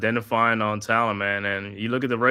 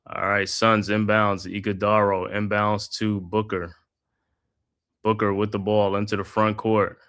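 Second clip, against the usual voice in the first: steady broadcaster's call of the action; live basketball play-by-play commentary. Acted. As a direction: call the game like a courtside announcer. Calls the game play by play.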